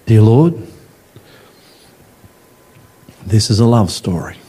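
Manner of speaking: low, measured prayer voice with long pauses; closing prayer. Real, not acted. Preacher praying to the Lord for the congregation, low measured prayer.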